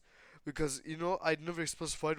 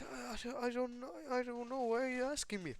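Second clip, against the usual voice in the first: higher scared voice; scared denial; 9 semitones higher than usual. Retold or imitated. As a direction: protest helplessly. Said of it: "Ray's like".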